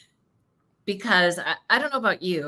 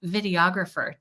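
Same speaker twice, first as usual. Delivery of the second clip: usual speaking voice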